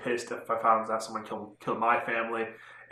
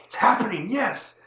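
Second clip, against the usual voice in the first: higher, swooping mock-squeal of joy; excited fan cheer; 9 semitones higher than usual. Fan reliving the moment Queens appears, excited cheer. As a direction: relive the thrill, pitch leaping up.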